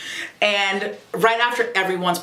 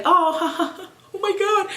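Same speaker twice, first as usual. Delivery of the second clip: laughing sob; slower than usual. Retold or imitated. Reacting to sad film.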